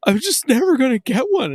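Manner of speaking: high-pitched imitated crying kid; tearful whining. Crying kid confessing to dad, tearful whine. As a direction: wail it in mock childish despair.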